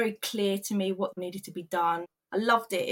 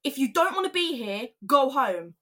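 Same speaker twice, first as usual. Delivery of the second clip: higher, retold exasperated outburst; frustrated; 5 semitones higher, 3 dB louder than usual. Colleague losing patience with the struggling worker, frustrated. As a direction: imitate a fed-up colleague, louder on the end. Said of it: "he's getting really frustrated with me".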